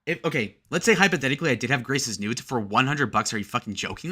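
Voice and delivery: ordinary delivery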